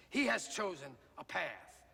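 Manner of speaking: usual speaking voice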